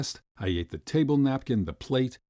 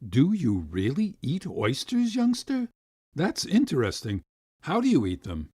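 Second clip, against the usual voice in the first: amused gentleman; 4 semitones higher than usual. A narrator's reading. Gentleman laughing at the boy.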